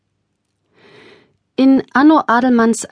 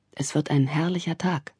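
whisper; 4 semitones lower, 8 dB quieter than usual. A narrator's reading. Old man content at window.

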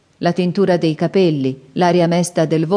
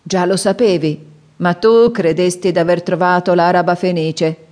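plain reading voice

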